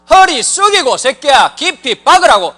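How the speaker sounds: loud, high shouted orders; barking drill sergeant. Pretend. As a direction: yell at recruits in the field. (높고 크게 호통치는 조교)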